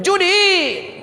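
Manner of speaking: high, drawn-out cry; hammed-up mother calling while rocking; mock rocking call. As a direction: stretch the word out high. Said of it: "Apa katanya sambil ngayung?".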